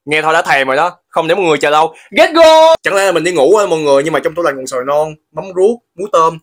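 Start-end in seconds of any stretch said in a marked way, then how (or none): 2.1-2.8 high loud catchphrase yell; excited shout. Acted. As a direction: shout it like a show host. Catchphrase launching each challenge.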